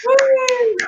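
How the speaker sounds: long, high "woo"; high-pitched cheer. Real, not acted. MC cheering her own festival news, high whoop.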